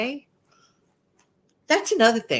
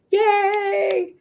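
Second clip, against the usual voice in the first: excited cheer; 4 dB louder than usual. Real, not acted. Cheering for fall season.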